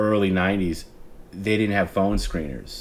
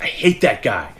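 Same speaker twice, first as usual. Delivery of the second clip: mock fury, strained and a little higher; infuriated Howard; 4 semitones higher, 4 dB louder than usual. Imitating the host triggered by a caller, infuriated. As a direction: imitate a radio host's outburst. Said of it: "Howard would get infuriated by it".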